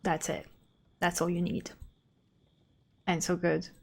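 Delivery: normal talking voice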